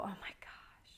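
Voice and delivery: hushed, whispered disbelief; awed whisper. Retold or imitated. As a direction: whisper it to yourself, stunned. Awed private reaction, retold.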